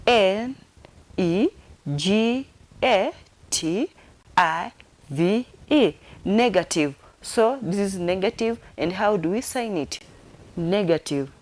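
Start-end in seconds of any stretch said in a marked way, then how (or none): 0.1-5.9 slow letter-by-letter spelling; spelled out. Shown as a demonstration. Teacher finger-spelling negative for learners, spelled out. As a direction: give each letter its own beat. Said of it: "Let me repeat it or come again".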